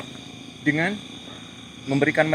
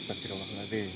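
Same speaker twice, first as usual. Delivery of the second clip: hushed frightened prayer; 7 semitones lower, 14 dB quieter than usual. Real, not acted. Reacting to apparition.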